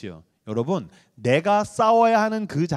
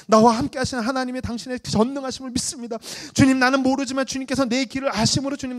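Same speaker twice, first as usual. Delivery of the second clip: higher, louder, swelling into song; resolute prayer; 8 semitones higher, 4 dB louder than usual. Retold or imitated. Pastor's prayer turning from despair to bold trust, swelling. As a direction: build faith into a sung cry.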